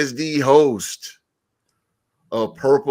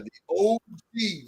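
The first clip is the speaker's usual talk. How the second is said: usual speaking voice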